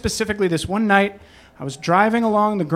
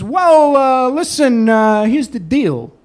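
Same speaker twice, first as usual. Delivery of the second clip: schmooze the stranger like a fixer; husband on the phone buttering up the cab driver; smooth-talking husband; 5 semitones higher than usual. Retold or imitated. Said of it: "And he says".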